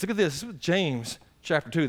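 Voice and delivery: normal talking voice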